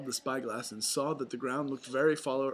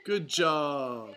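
plain reading voice

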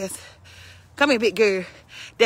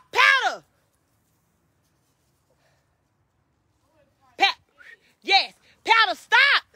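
scolding; 9 semitones higher than usual. Real, not acted. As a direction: bark orders at the misbehaving dog. Scolding the dog Powder.

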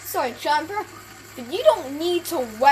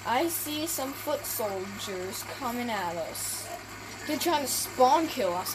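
usual speaking voice

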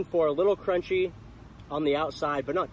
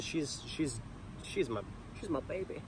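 tender; 11 dB quieter than usual. Real, not acted. Tender about her dog.

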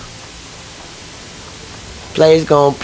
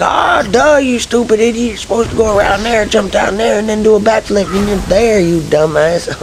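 high, fast taunting know-it-all; a mock backseat gamer, pitched high; mocking viewer; 7 semitones higher than usual. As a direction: mimic a smug viewer scolding the player. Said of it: "they're just like".

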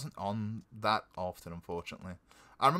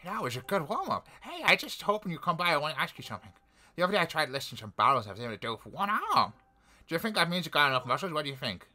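put-on higher, eager young villager; character voice; 5 semitones higher than usual. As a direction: gush with happy thanks. Voicing NPC dialogue.

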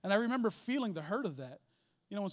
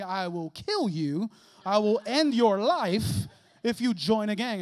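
slow, menacing, growing louder; stern father's threat; 8 dB louder, slower than usual. Retold or imitated. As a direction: threaten your son slowly.

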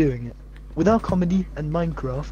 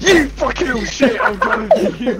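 playful mock rage; 7 semitones higher, 4 dB louder than usual. Mock chase in game.

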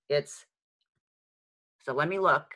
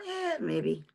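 noncommittal maybe; 7 semitones higher, 6 dB quieter than usual. Acted. Acting out a lukewarm answer.

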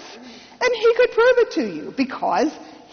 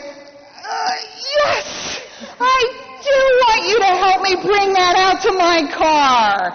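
strained acceptance; 6 semitones higher, 3 dB louder, slower than usual. Retold or imitated. Forcing herself to accept help.

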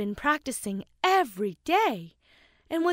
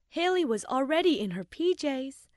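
usual speaking voice

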